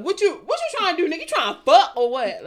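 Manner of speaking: loud, high, challenging put-on accent. Body part challenging a would-be lover, loud put-on accent. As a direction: confront them with exaggerated sass. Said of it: "In [name]'s accent".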